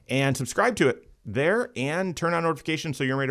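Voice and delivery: high, energetic host read; radio show intro. Acted. Host welcoming listeners and plugging the YouTube channel, upbeat announcer delivery. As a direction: lift the voice and sell the show.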